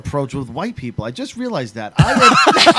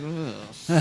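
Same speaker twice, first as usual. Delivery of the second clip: disgusted groan; 7 semitones lower, 11 dB quieter than usual. Retold or imitated. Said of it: "I'd be like".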